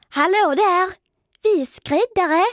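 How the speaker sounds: high, loud, strange-sounding chorus of bugs; water striders in chorus. A narrator's reading. Water striders answer Pindel together, high loud chorus. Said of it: "svarade de i kör".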